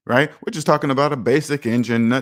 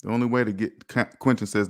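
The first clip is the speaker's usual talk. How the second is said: normal talking voice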